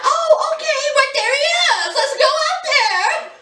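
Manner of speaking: high-pitched mock giddy squeal of delight; giddy, bubbly. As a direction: imitate her foolish bubbliness, way up high. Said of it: "She's all giddy and, and, and, you know, bubbly".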